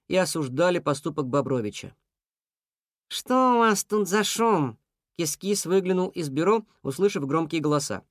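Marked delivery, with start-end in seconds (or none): 3.1-4.8 puzzled cat. A narrator's reading. Cat hears loud voices.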